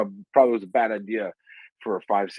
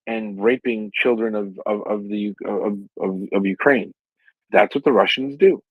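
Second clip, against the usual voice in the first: ordinary talk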